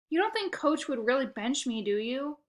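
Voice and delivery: usual speaking voice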